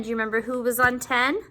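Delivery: usual speaking voice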